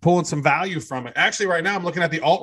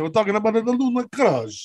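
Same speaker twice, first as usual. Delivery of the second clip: put-on light Italian accent, pitch up; hint of an Italian accent, played for laughs; 6 semitones higher than usual. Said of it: "go back to your Italiano accent".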